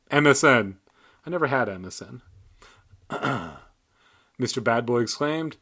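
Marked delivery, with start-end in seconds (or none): none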